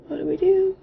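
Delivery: high, nervous panicked question; mock panic. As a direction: voice the scared couple freezing up. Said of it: "we're both just like".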